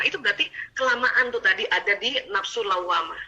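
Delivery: ordinary delivery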